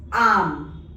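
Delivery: "arm" with a longer open "ah"; lengthened vowel. Shown as a demonstration. Teacher reading the French for soul, long vowel in "arm". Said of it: "it has a bit longer sound".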